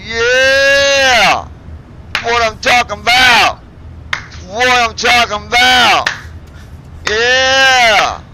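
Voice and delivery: loud triumphant cheer; excited shouting. Real, not acted. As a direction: yell it out in victory. Cheering after takeoff.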